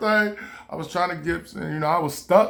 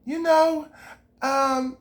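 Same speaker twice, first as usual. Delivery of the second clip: lightly voiced impersonator; 8 semitones higher than usual. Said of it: "Michael looked at me like".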